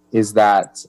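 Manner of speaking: ordinary talk, nothing marked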